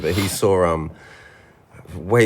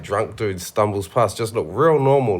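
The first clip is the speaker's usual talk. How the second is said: ordinary talk, nothing marked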